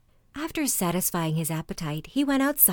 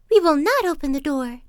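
put-on high, loud refusal in little kids' voices; kids crying out; 8 semitones higher, 9 dB louder than usual. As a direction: cry out defiantly, high and loud. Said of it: "they cried out".

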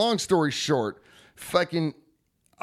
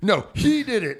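mock blame-shifting; 7 semitones higher, 4 dB louder than usual. Retold panicked passing of blame.

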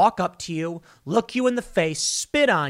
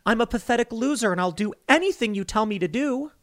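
mock whiny begging; 7 semitones higher than usual. Mocking officers begging the mayor.

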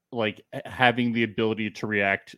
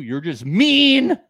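mock hurt whine; 7 semitones higher, 7 dB louder, much slower than usual. Gilmer quoted, louder higher slow.

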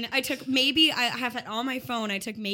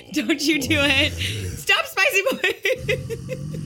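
laughing protest; 5 semitones higher, 6 dB louder, much slower than usual. Real, not acted. Laughing at Tom's mic antics.

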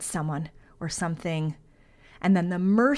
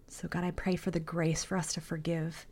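softer, half-whispered plea to God; whispered prayer; 2 semitones lower, 7 dB quieter than usual. Real, not acted. Pastor praying for her listeners, softly whispered. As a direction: breathe the prayer gently.

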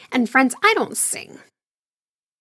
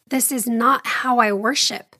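ordinary delivery

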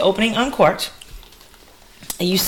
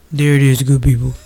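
low, loud announcer-like put-on voice; hammed-up deep voice; Gardner; 5 semitones lower, 5 dB louder than usual. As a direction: drop the pitch and project it playfully. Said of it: "That's my Gardner voice".

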